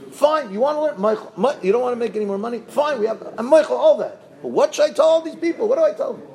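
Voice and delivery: high, exasperated scolding; angry wife. Acted. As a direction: demand an answer from him. Wife keeps scolding husband.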